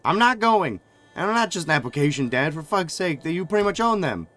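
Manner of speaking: furious, high, loud yelling; angry shout. Acted. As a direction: yell it at your father. Daughter shouting at her father.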